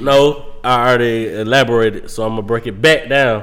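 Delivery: deep, low man voice. Real, not acted. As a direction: drop the voice deep and heavy. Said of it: "Oh, that was bass coming out".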